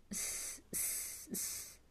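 soft sibilant snake hiss; creature hissing. Acted. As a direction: hiss under the breath. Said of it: "said Gollum".

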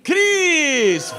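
high, drawn-out cheer; celebratory name reveal. Real, not acted. Pastor confirms who the mystery voice was, celebratory. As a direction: sing out the name in high delight. Cheering a correct guess in game.

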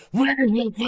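Batman. Retold or imitated. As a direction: growl the question in an exaggerated hero voice. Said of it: "I go to see Batman in movies. He's like".

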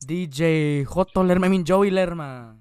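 ring announcer intro. Pretend. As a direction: announce the DJ like a fight-night main event, stretching it out. Hyped introduction of the DJ.

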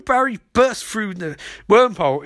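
usual speaking voice

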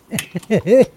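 loud high burst of laughter; shrieking laugh. Real, not acted. Cracking up after reading.

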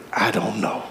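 low, soft, pious admission; good holy. Shown as a demonstration. Preacher shows congregation a good holy "I don't know", low and solemn. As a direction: say it low with mock holiness. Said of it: "Ain't nothing like a good holy".

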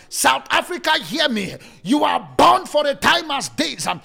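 fiery shouted sermon; preaching at full force. Acted.

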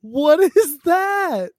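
high, slow, drawn-out disbelief; loud bewilderment. Real, not acted. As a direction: cry out loudly, totally baffled. Baffled by sudden quacking.

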